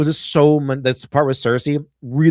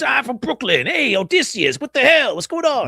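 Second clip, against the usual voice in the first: much higher, brash Brooklyn wiseguy voice; mock Brooklyn accent; 12 semitones higher than usual. Leguizamo's character hailing Odysseus, much higher Brooklyn accent. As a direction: ham up a Brooklyn accent like a street tough. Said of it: "John Leguizamo's stupid voice".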